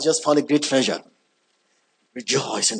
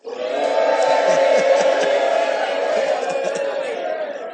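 burst of laughter. Real, not acted. Laughing at own Bible-zeal joke.

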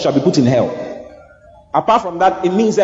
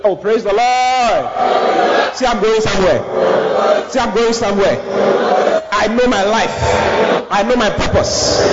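ringing, higher-pitched church call-and-response; preaching declaration; 5 semitones higher than usual. Real, not acted. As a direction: proclaim it for the crowd to repeat. Leads congregation in declarations.

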